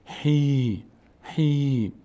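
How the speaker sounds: "هی" held out on a long "ee". Shown as a demonstration. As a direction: stretch the vowel of "هی". («هی» با صدای ای کشیده)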